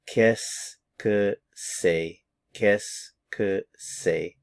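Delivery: Qu'est-ce que c'est slowed right down, sounds run together; slow listening demo. Shown as a demonstration. Teacher slows it for eyes-closed listening. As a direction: let each sound land slowly. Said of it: "just listen to the sounds".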